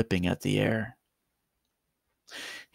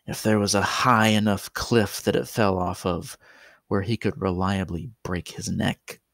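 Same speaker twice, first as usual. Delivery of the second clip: plain reading voice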